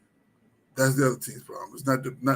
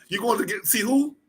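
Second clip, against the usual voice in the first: ordinary talk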